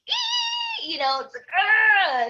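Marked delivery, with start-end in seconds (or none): 0.1-0.9 high, drawn-out "eee" squeal; cringing squeal. Acted. Imitating dentist tools that make you cringe, squealing. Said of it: "tools at the dentist". 1.6-2.3 loud, high shuddering "ugh"; cringing groan. Acted. Reacting to nails on a chalkboard, cringing groan. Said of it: "it makes me cringe".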